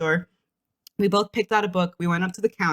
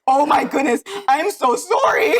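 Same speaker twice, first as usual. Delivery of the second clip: flustered clerk apology; 6 semitones higher, 5 dB louder than usual. Retold or imitated. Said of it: "the color went out of his face".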